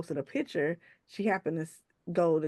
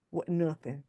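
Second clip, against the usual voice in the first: ordinary delivery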